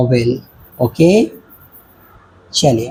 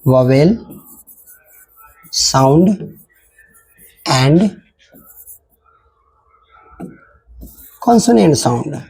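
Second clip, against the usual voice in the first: slow dictation with long pauses between words; teacher dictating the definition slowly; 5 semitones lower, much slower than usual. Shown as a demonstration. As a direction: dictate it word by word for notes. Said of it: "dictating a definition".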